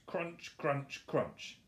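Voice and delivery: high, far-off munching voice; crunching sound. A narrator's reading. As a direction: chomp it out greedily. Boar eating noise in story.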